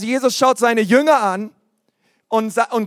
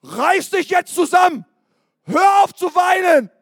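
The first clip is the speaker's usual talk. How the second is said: higher, barked orders; mock stern command; 8 semitones higher than usual. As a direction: bark at someone to stop crying. Mock ordering feelings to stop.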